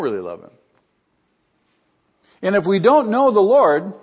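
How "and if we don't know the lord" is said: loud, higher cry from the pulpit; preaching at full force. Acted. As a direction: drive it home to the congregation. Pulpit climax.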